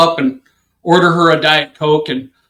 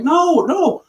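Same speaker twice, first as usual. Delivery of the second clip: high, imitated group protest; retold protest; 12 semitones higher than usual. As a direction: wave it away in a high mock voice. Passengers protest in retelling.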